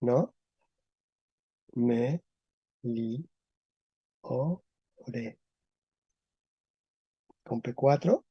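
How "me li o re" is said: "Me, li, o, re" softly, each syllable apart. Shown as a demonstration. Teacher breaks the word down for class, syllable by syllable. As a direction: say "Me, li, o, re" as four separate syllables. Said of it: "meliore en español".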